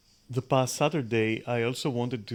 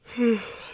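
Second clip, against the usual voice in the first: big open-mouthed yawn; long sighing yawn; 11 semitones higher than usual. Real, not acted. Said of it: "you're gonna hear a lot of yawning".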